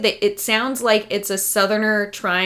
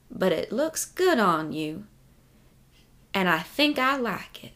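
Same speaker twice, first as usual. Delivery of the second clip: softer, slow, posh Virginia drawl; Virginia Southern accent; 7 dB quieter than usual. Retold or imitated. Virginian man to mom's clothing, posh Virginia accent. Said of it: "my interpretation of, like, a Virginian accent".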